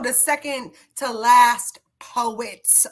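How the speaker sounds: ordinary delivery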